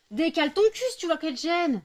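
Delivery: high, loud telling-off of the dog; exasperated owner scolding her dog; scolding yell. Real, not acted. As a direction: snap at the dog to move over.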